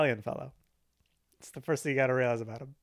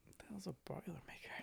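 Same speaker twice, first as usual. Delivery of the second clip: whisper; 23 dB quieter than usual. Real, not acted. Joking aside about drinks.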